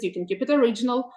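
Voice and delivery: usual speaking voice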